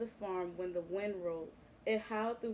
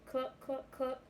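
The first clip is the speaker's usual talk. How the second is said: lightly voiced clucking hens; 4 semitones higher, 3 dB quieter than usual. A narrator's reading. Reading to kids, hens cluck in the wind. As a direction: cluck like a fussy hen. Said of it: "the hens cried".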